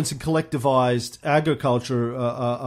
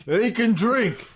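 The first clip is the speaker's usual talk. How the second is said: Stalin impression; 8 semitones higher, slower than usual. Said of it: "My foreign minister".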